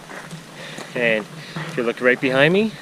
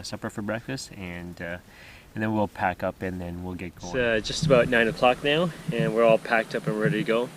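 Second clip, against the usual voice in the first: ordinary talk, nothing marked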